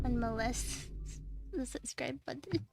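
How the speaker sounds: soft unvoiced whisper; whispered joke. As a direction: breathe it out as a sly aside.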